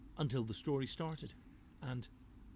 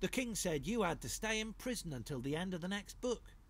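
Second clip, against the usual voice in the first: Blimey explaining; 6 semitones higher than usual. Acted. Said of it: "said Blimey O'Reilly".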